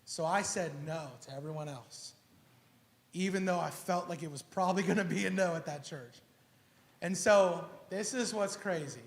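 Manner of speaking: ordinary delivery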